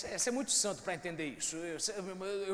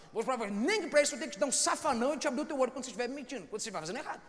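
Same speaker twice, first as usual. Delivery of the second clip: loud, higher impassioned sermon; fervent preaching; 4 semitones higher, 5 dB louder than usual. Real, not acted. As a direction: pour it out at full force. Preaching at full force. (pregação fervorosa, alta e intensa)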